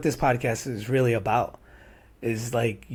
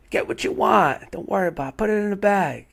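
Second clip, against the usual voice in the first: higher-pitched voice of a friend treating you; insistent generous friend; 8 semitones higher than usual. Retold or imitated. Friend treating his broke buddy at Target, higher insistent voice. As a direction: wave off the worry, pitched up. Friend urging him at the store.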